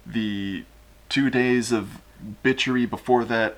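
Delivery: usual speaking voice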